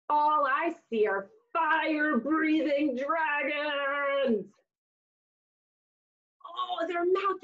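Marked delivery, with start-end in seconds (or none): none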